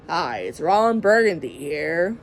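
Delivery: low, slow news anchor voice; Ron Burgundy impression. Mom imitating Ron Burgundy for her vlog, low slow anchor voice. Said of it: "like a news anchor".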